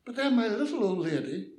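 usual speaking voice

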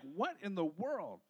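low, slow parental disbelief; mock bafflement. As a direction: play the mildly stunned parent, drawn out. Parents baffled by child's outburst.

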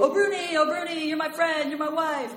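mock-shouted greetings from passers-by; crowd calling out. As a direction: imitate the villagers calling after her. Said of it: "everyone is shouting at you".

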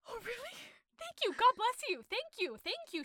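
high-pitched gushing thank you. Retold or imitated. Maid thanking the master for letting her go, high and gushing. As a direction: squeal your thanks in relief. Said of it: "she's like".

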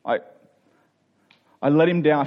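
usual speaking voice

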